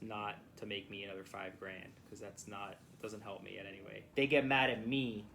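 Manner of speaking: ordinary talk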